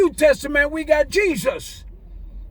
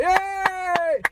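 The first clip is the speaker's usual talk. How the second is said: mock cheering crowd; 5 semitones higher than usual.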